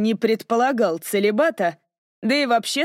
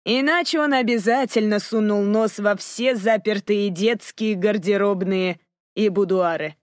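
ordinary delivery, nothing marked

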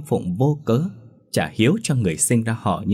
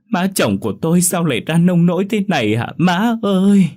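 loud, high, theatrical fake lament; fake wailing; 8 semitones higher, 5 dB louder than usual. A narrator's reading. Daughter-in-law wailing falsely over mother-in-law's coffin, loud theatrical wail. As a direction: wail it like crocodile tears at a funeral. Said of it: "gào hét thảm thiết, giống như mèo khóc chuột".

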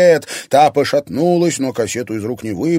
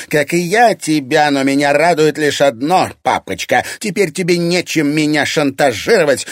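raised, loud, spiteful crowing; triumphant shout; 5 semitones higher, 4 dB louder than usual. A narrator's reading. Irina telling father his blackmail is over, triumphant shout. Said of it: "крикнула Ирина Егору".

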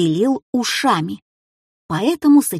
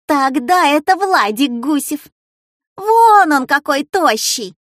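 excited shouting; 6 semitones higher, 4 dB louder than usual. A narrator's reading. Classmate Людка guessing another boy's name, excited shouting. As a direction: shout out a new guess, pitched high. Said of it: "снова закричала Людка Пустякова".

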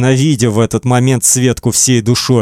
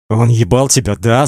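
ordinary delivery, nothing marked

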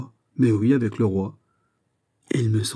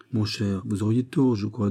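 plain reading voice

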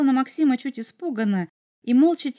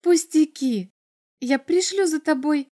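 higher, lightly voiced little girl; breezy bird girl; 5 semitones higher than usual. Bird girl promising Maxim transport, lightly breezy. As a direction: wave the worry away in a lightly girlish voice. Girl brushes off his worry.

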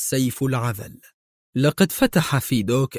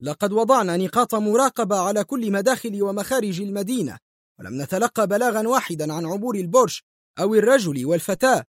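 high-pitched agitated complaint; urgent, higher voice; exclaiming; 7 semitones higher than usual. A narrator's reading. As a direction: press the complaint in a raised pitch. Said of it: "هتف مايكل".

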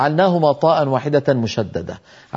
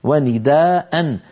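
ونداء drawn out slowly with the tanween ending -dan; slow demonstration; much slower than usual. Shown as a demonstration. Teacher repeating the original form ونداء, very slowly. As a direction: spell out ونداء slowly for the class. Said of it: "ولأن أصلها ونداء".

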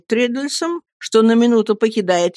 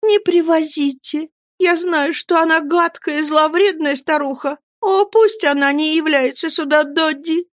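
put-on high girlish voice, scared and pleading; childish pleading; 9 semitones higher than usual. Dora continues pleading.

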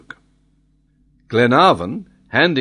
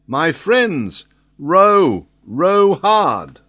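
loud, raised rallying call; urgent cry; 5 semitones higher, 5 dB louder, much slower than usual. A narrator's reading. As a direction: urge the crew on at the top of your voice. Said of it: "he cried".

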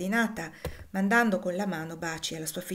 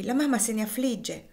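ordinary delivery

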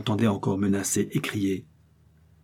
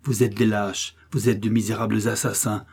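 ordinary delivery

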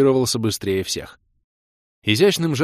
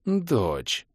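soft purr close in the ear; purring whisper; 3 semitones higher, 4 dB quieter than usual. A narrator's reading. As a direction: purr it softly into his ear. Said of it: "промурлыкала мне в ухо Кира".

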